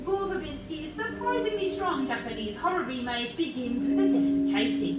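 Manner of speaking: normal talking voice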